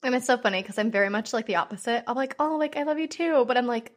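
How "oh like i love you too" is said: high, put-on sweet reply; awkward affection. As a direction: return the love in an awkward high voice.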